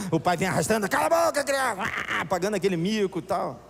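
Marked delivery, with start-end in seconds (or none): 0.9-1.8 higher, louder father's scolding shout; mock angry yell. Father dragging screaming child out, mock angry shout. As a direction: bark at the kid like an embarrassed dad.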